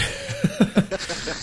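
airy unvoiced laugh; breathy laugh. Real, not acted. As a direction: laugh it out silently. Laughing fit after sneeze.

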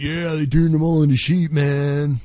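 laid-back stoner drawl; dazed dude, man. Acted. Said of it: "I got a little lost in the whole stoner thing".

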